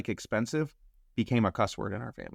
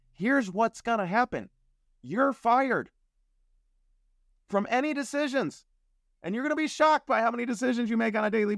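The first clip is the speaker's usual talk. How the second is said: forceful instructions; 9 semitones higher than usual. Retold or imitated. Coach's orders retold, raised voice.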